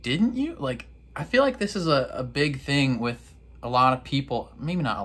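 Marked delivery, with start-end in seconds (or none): 0.0-0.6 high, pointed didn't you; a high surprised jab; mock incredulous. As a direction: ask it in disbelief. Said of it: "And Daisy's like".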